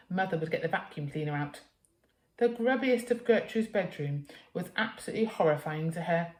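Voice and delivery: usual speaking voice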